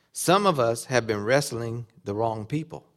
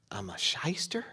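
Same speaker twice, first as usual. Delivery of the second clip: stunned realization; 2 semitones lower, 8 dB quieter than usual. Pretend. Jacob realizing his true name.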